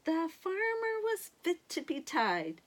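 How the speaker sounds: ordinary delivery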